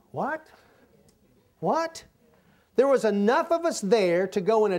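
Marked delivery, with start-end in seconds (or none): 0.1-2.0 short, high disbelieving question; baffled, high-pitched "what"; incredulous reaction. Retold or imitated. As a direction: ask it in stunned disbelief, pitch jumping up. Acting out his astonished reaction.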